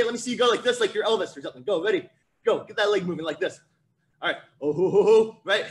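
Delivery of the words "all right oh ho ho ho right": loud, playful rock-and-roll star impersonation; hammed-up crooner's exclamation. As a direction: belt out a jokey singer's "oh ho ho". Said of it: "That's my [name] impersonation".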